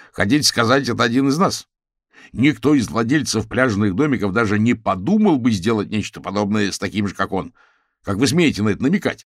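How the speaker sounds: affronted public outburst; indignant. A narrator's reading. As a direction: take offence loudly before a crowd. Frank angrily accuses before crowd.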